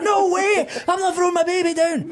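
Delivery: very high, loud frantic woman's refusal; shrieking mother. Retold or imitated.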